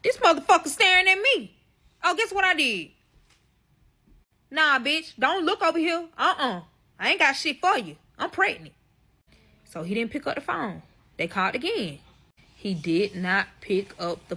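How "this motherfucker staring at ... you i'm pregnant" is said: high, louder, playful inner voice; imagined thought. Retold or imitated. Imagining the husband's thoughts while the police call, pitched up and playful. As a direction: squeal the thought like a defense. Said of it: "The whole time he thinking".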